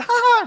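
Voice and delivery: high, loud mock cackle; mischievous high "ha ha"; devilish child laugh. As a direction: imitate a gleeful little devil. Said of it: "grinning like a devil, going".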